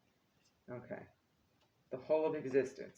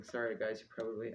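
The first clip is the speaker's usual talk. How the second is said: usual speaking voice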